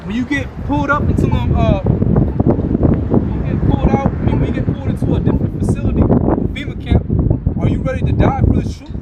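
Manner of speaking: loud, rising, hoarse-edged preacher's cry; preaching shout. Real, not acted. Street preacher challenging listeners to face death, shouting. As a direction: hammer the challenge home to the congregation.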